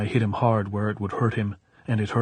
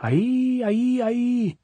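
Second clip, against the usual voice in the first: very high, drawn-out cry; sustained high-pitched holler; drunkard's shout; slower than usual. A narrator's reading. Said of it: "commenced to shout".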